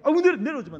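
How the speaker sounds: loud, high, hurried protest; fussy parental refusal. Acted. Parent on the phone telling the child not to visit, fussy protest. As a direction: wave the child off loudly.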